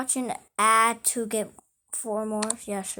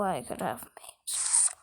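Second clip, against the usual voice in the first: whisper; 7 semitones lower, 13 dB quieter than usual. Real, not acted. Whispered regret.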